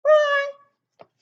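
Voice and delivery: high squeaky tiny roar; a mouse trying to roar, high and loud; squeaky little roar. Acted. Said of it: "there's his little roar".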